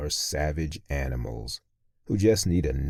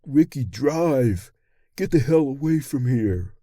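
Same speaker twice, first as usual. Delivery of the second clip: high, loud panicked yell; urgent high-pitched shout from a car passenger; 12 semitones higher, 6 dB louder than usual. A narrator's reading. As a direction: yell it to the driver, high and urgent, drawing out the first words. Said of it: "One even yells out".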